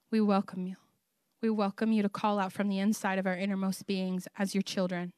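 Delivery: hushed, reverent prayer of welcome; soft prayer. Real, not acted. Pastor welcomes the Holy Spirit over the congregation, soft prayer. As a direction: pray it low and soft, easing into a hush.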